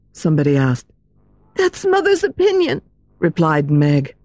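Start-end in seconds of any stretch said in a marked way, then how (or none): 1.6-2.8 put-on high, girlish frightened cry; little girl crying out. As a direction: cry out like a scared young girl. Meg's voice.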